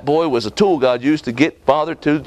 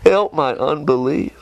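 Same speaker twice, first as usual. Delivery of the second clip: pleading father; 2 semitones higher than usual. Acted. Father pleads with Jesus, crying.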